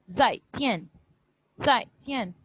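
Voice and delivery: "zai tian" short, each syllable falling; unstretched falling tone. Shown as a demonstration. Teacher has students hear the difference, unstretched falling tones. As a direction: drop quickly on zai and tian, no stretch. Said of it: "कोई stress या stretch नहीं कर रही".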